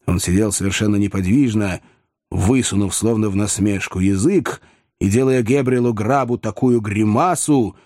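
ordinary delivery, nothing marked

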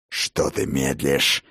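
low, slow, menacing growl; furious growl. Acted. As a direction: growl it at him, impatient and furious. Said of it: "яростно зарычал отец дружин".

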